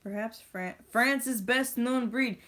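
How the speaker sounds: loud, high, slow triumphant reading; triumphant reading of the answer; excited reveal. Real, not acted. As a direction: build up to it and land it loud.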